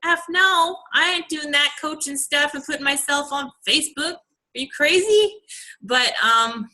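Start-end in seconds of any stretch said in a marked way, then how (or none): 0.0-5.4 hammed-up are you crazy; mock outraged refusal. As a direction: scoff at the idea as absurd. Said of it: "I was like".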